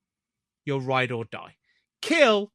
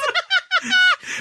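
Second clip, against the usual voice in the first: high laugh; 3 dB louder than usual. Real, not acted. Laughing at the joke.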